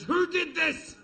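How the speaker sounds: high, drawn-out angry demand; furious mum. Retold or imitated. Furious mum demands to know who made the mess, high-pitched outrage. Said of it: "she's fucking furious".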